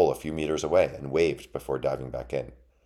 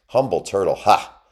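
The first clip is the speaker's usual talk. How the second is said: loud, higher mocking echo of "Humble turtle"; scornful laugh; 6 semitones higher, 9 dB louder than usual. A narrator's reading. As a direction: throw "Humble turtle" back with a derisive "ha!". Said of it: "laughed the mouse shadow".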